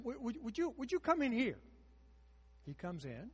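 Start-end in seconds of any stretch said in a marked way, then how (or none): 0.0-1.6 pleading call. Retold or imitated. Woman begging preacher to come.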